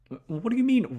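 ordinary delivery

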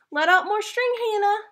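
high, loud call across the beach. Acted. Laura calling to Hannah flying the kite, high loud call. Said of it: "called Laura".

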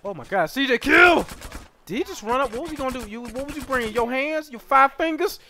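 high, loud taunting; mock the enemy for bringing fists; hyped trash talk. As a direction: gamer roasting rivals mid-fight. Trash talking enemies in game.

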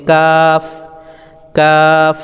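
কাফ from the back of the tongue, drawn out; Arabic letter qaf. Shown as a demonstration. Teacher demonstrating কাফ to students, chanted. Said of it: "এভাবে আপনারা কাফটি উচ্চারণ করবেন".

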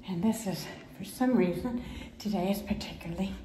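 airy whisper, short of breath; breathless whisper. Real, not acted. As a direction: whisper it, winded. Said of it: "trying to breathe and talk".

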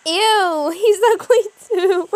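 loud, high "Ew" and cackle; mock him with a shriek of laughter; high loud disgusted laugh; disgusted jeer. Jeering at character.

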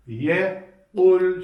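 yekul slow, heavy kaf and thin lam; thick kaf. Shown as a demonstration. Teacher slowly demonstrating how yekul should sound. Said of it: "kaf kalın, lam ince okunacak".

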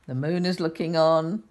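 ordinary delivery

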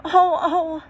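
high, loud cry of dismay; distressed cry. A narrator's reading. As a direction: cry out in shock and grief. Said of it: "cried the two girls".

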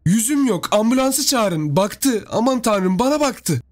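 high-pitched cries of terror; acted panic, high voice; helpless, horrified. A narrator's reading. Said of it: "Sesi çaresiz ve gerçekten dehşete düşmüş gibiydi".

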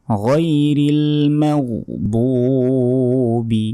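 slow chanted গয়রিল মাগদুবি, drawn out; Quran recitation. Shown as a demonstration. Recites verse in melody.

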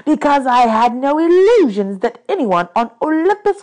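high, loud, bitter outburst; snarling. A narrator's reading. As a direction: snarl like a wronged god. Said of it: "Hades snarled".

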